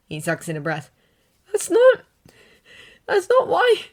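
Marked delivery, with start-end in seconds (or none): 1.5-3.9 high, halting, pushing past tears; can't get the words out; upset boy. A narrator's reading. Izuku correcting All Might, halting and upset.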